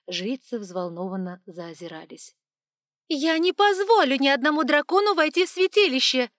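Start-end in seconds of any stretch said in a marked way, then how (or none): 3.1-6.3 high, loud, close to a shriek; nearly shrieking. A narrator's reading. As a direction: let the voice almost crack into a squeal. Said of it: "Голос Эванс едва не сорвался на визг".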